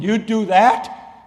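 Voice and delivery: high, loud, slow "you do that?"; astonished. Retold or imitated. Friend on the phone, stunned, loud and high. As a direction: blurt it out in disbelief.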